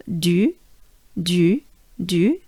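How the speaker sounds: "du" with a droopy-faced French U. Shown as a demonstration. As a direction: droop the face and repeat "du" separately. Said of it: "Again, the droopy face".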